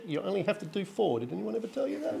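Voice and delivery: quiet, laughing aside to the crowd; amused aside. Real, not acted. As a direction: drop the voice and chuckle. Joking aside to the audience.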